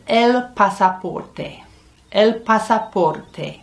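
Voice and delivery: "el pasaporte" slowly, every syllable clear, rolled r; slow Spanish model. Shown as a demonstration. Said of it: "The third word".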